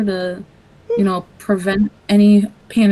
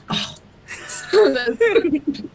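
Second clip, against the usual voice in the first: laughing fed-up groan; 5 semitones higher, much slower than usual. Retold or imitated. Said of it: "I'm like".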